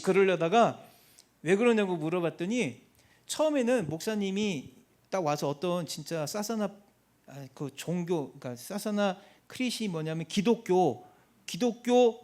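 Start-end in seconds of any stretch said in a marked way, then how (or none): none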